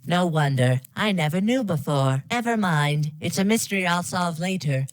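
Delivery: ordinary talk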